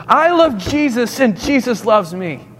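high-pitched, slow, repeated proclamation; boy's insistent declaration. Retold or imitated. Boy repeating himself over a laughing chapel, insistent declaration. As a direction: imitate a boy declaring it with each phrase starting high and falling.

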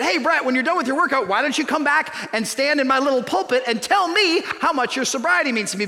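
hammed-up eagerness; eager invitation.

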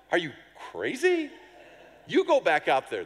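incredulous. Retold or imitated. To the students wanting to preach again, incredulous. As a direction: toss it back like they've lost their minds.

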